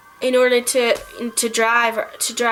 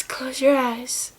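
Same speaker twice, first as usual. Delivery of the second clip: acted sadness; 3 dB quieter, slower than usual. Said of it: "he's sad, you can tell".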